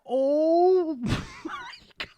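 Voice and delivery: stunned laugh at a friend's violence; laughing disbelief. Real, not acted. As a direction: laugh through the shock. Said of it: "I'm looking like".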